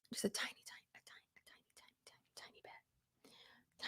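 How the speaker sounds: barely audible whisper, the words repeated. Pretend. Vlogger applying just a bit of blush, whispering. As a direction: whisper it to yourself.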